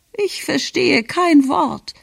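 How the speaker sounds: very high, slow complaint; uncomprehending grandmother. A narrator's reading. Grandmother complains she can't follow the speech, high and slow. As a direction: draw it out in a high old-lady voice.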